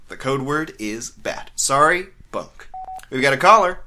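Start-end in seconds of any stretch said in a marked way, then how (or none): 2.3-2.7 quiet correction. Acted. Correcting himself after slip.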